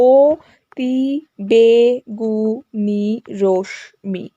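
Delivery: "অতি বেগুনি রশ্মি" very slowly in seven drawn-out syllables. Shown as a demonstration. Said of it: "এটা হচ্ছে কিন্তু সাতটা দল". ("অতি বেগুনি রশ্মি" সাত দলে টেনে টেনে)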